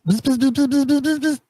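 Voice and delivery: high buzzing gnat noises; mocking the rival with a high insect buzz. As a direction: mimic a pesky little gnat. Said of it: "you sound like, uh, one of those gnats".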